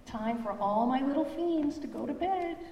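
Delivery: high, bright mama voice; mother monster voice. Acted. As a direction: call the little ones to bed. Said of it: "Mama Monster yawned".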